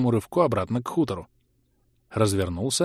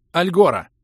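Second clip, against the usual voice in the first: shouted spell word; 6 semitones higher, 5 dB louder than usual. Acted. Casting a teleport scroll.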